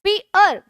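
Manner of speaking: Fear split into fi and yar; two stresses. Shown as a demonstration. Teacher pointing out two stresses, fear in two beats. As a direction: give both halves of fear their own beat. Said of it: "fear में दो जगह हमारा stress".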